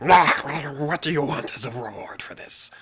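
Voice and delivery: raspy whispered creature voice; whispered kobold leader. Shown as a demonstration. GM voicing the kobold leader asking the hero, whispered. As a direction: hiss the question in a breathy whisper. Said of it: "Well, more like".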